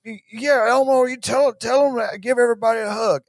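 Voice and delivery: mock a doddering leader's kindly message; halting start, then rushed folksy warmth; Joe Biden. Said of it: "from Joe Biden being like".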